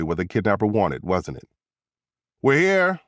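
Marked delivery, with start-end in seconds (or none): 2.4-3.0 high-pitched call to the back; yelling back. A narrator's reading. Ambulance driver to the agents behind, mild yell. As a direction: call it out, mildly raised. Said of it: "the ambulance driver yelled back".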